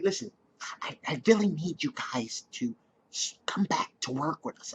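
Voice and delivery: breathy hushed pleading whisper; Lydia's whisper. Retold or imitated. Imitating Lydia begging the dealers in a whisper. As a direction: whisper it urgently, almost pleading. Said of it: "I like the way she talks".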